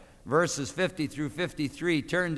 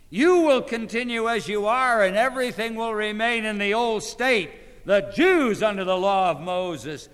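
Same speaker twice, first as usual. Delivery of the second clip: higher and louder, ringing declamation; full-force preaching; 6 semitones higher, 6 dB louder than usual. Acted. Preacher voicing Christ's words from Luther, full force. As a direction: thunder it from the pulpit.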